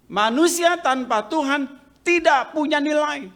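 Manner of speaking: preacher proclaims the theologian's line, loud and high; loud repeat. Shown as a demonstration. Preacher drives home the quote to the congregation, loud. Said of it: "seorang teolog mengatakan".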